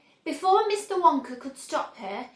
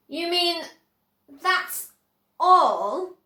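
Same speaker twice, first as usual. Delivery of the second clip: disgusted; 5 semitones higher, 6 dB louder, much slower than usual. A narrator's reading. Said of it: "said Mike Teavee, disgusted".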